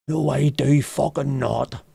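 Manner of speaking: slow mock indignant denial; quoting Thomas. Thomas denying he says it, slow mock indignation. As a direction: drag out the denial like an offended friend. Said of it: "he says".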